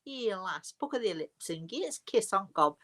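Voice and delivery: playful broad Yorkshire dialect; amateur Yorkshire accent, affectionately hammed up. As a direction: say it like a mock Yorkshire local. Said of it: "as we would say in Yorkshire".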